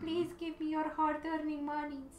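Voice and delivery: high pleading beggar voice; whiny begging voice. Acted. Begging viewers for Patreon money.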